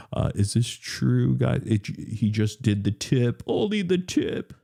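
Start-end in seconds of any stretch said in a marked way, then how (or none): 3.5-4.5 high squeaky voice. Pretend. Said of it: "repeats with rising pitch".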